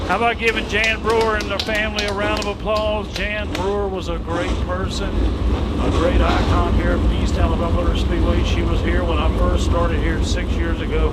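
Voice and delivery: booming tribute to the crowd; announcer voice. Acted. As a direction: sell it to the grandstands. Announcer rallies crowd over music.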